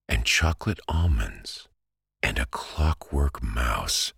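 plain reading voice

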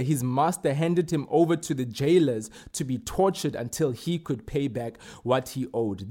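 ordinary talk

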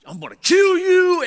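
high, loud outburst as the exasperated wife; hammed-up angry yell; mock furious wife. Wife snaps at him, retold.

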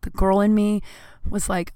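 usual speaking voice

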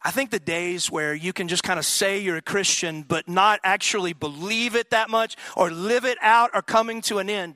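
climbing preacher's emphasis; preaching. Acted. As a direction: drive the point home from the pulpit. Repeated claim, now preached.

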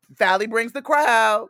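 high, loud, drawn-out boast; big excited shout-out; loud hype. Real, not acted. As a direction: proclaim it like a hype woman. Hyping the guest's drawing power.

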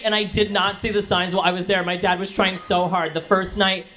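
ordinary talk, nothing marked

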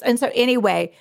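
ordinary talk

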